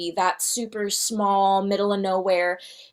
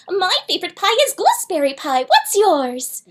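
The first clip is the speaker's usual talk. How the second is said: high, fast, sweet princess voice; chirpy fairy-tale princess; 10 semitones higher than usual. Shown as a demonstration.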